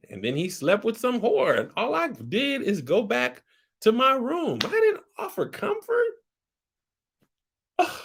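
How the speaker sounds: high, prim insistence on her innocence; innocent victim voice. Acted. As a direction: sell the lie in a wounded high voice. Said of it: "Rhaenyra stuck to her story".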